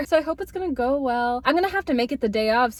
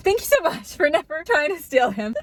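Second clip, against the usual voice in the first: high, giggly thank-you; laughing thanks; 6 semitones higher, 5 dB louder than usual. Real, not acted. Thanks friend sitting beside her.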